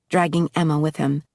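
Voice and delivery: plain reading voice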